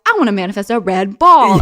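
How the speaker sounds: put-on loud childlike declaration; twelve-year-old self. Twelve-year-old choosing what to manifest, hammed-up declaration. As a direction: announce it like a determined kid. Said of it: "I'm, like, twelve being like".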